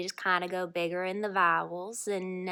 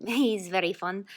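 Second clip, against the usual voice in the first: ordinary talk, nothing marked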